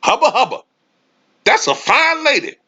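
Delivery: very high, hammed-up catcall; mock catcall. Kawhi admiring Skip's wife, hammed-up high catcall. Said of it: "Kawhi ... walk by and said".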